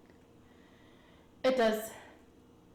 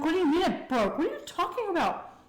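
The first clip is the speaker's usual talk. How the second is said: retold incredulity; 9 semitones higher than usual. Teenage girl confronting her panicking boyfriend, retold incredulous outburst. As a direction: demand an explanation, pitch leaping up.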